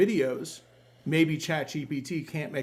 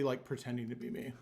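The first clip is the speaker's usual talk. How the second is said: ordinary delivery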